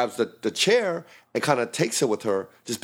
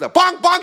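loud, much higher bang bang; shouted bang; 7 dB louder than usual. Retold or imitated. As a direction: shout the hits. Retelling kicks to the ribs.

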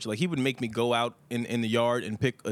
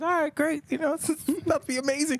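put-on high kid voice; 13 semitones higher than usual.

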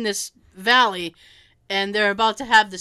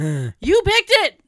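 mock accusing; 6 semitones higher, 5 dB louder, much slower than usual. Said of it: "I'm like".

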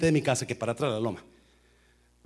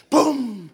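loud high crash; imitated crash; crash sound; 9 semitones higher, 6 dB louder than usual. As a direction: bang it out like an impact. Car hits mailbox.

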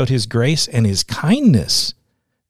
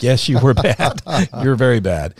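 amused quote, laughing through the words; laughing retelling; 2 semitones higher, 4 dB louder than usual. Retold or imitated. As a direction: relay the blunt verdict while chuckling. Laughing while retelling boss's blunt agreement.

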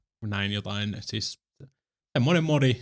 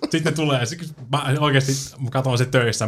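normal talking voice

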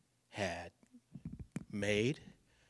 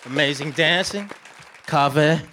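louder, higher shout-out to the crowd; crowd hype; 6 semitones higher, 10 dB louder than usual. Real, not acted. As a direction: pump up the room for the dancers. Hyping the crowd on stage.

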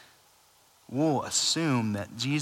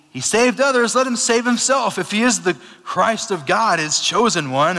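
mocking scoff; 8 semitones higher, 7 dB louder than usual. Ruler scoffs at crucified Jesus.

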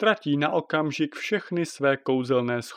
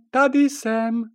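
high, loud repeated call; calling out again; 12 semitones higher, 6 dB louder, slower than usual. Acted. Princess Žofie calling again from the window, high and loud. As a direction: call louder to be noticed.

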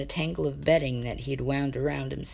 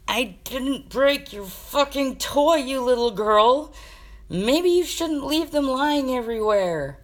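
higher, louder, slow furious yell; shout; 10 semitones higher, 6 dB louder, slower than usual. Acted. Sasha yelling at Jaden over a broken toy, loud and furious. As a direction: bellow it at the brother. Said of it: "heard Sasha shout".

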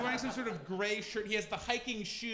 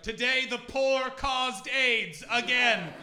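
loud, slow mock headline; hammed-up bellowed proclamation; ranting right-wing newspaper; 6 semitones higher, 9 dB louder, much slower than usual. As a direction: proclaim it like an outraged tabloid, high and drawn out. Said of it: "the really right-wing one".

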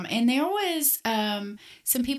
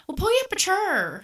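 put-on higher, broad country accent on chur; 9 semitones higher than usual. Imitating Aunt Jonna inviting you to sit, broad country accent. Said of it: "And she'll say".

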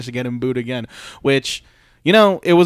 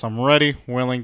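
usual speaking voice

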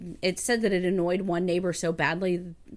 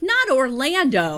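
loud, slow, higher-pitched mock lament; dismayed father; 6 semitones higher, 8 dB louder than usual. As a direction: wail it as a hammed-up movie dad. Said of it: "and the father's like".

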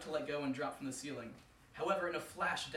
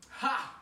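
scoffing laugh; 10 semitones higher, 7 dB louder than usual. Acted. Death scoffs at Anansi.

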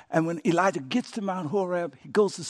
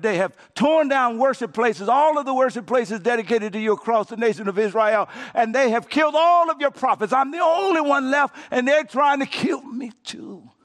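complaint building to a shout; 4 semitones higher than usual. Acted. Elijah complaining to God, building from complaint to a desperate shout. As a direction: build the grievance until you're shouting.

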